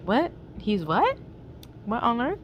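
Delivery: sharply rising mock questions; flabbergasted. We react to the accusation, acted flabbergasted. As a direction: act baffled, each question lifting at the end. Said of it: "like, flabbergasted".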